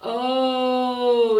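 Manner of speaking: "Oh" held long and open. Shown as a demonstration. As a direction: stretch the vowel out. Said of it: "It's a long sound".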